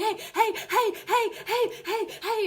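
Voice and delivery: high, loud, rapid-fire yapping heys; be a jumping dog demanding attention; frantic repeated high yelps of "hey"; overexcited dog. Retold or imitated. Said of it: "if he's jumping, he's like".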